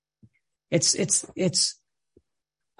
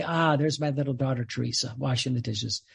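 tender fatherly voice; 6 semitones lower, 5 dB quieter than usual. Retold or imitated. God the Father watching Teresa, tender and soft. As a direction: lower and softer, a fond father. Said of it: "the father would look down and say".

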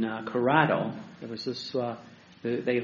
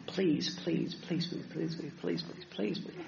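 begging; 8 dB quieter than usual. Acted. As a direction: mimic a meek, insistent beggar, much softer. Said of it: "it's not begging".

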